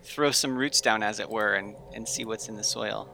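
ordinary delivery

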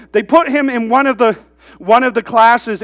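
ordinary delivery

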